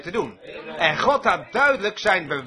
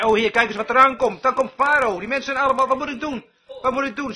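higher-pitched, mildly alarmed appeal; imitated panicked plea; 4 semitones higher than usual. Moses to the Lord as Pharaoh approaches, imitated high-pitched alarm. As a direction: plead as Moses at a loss, pitched up.

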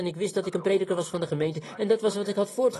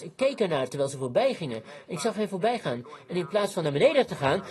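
ordinary talk, nothing marked

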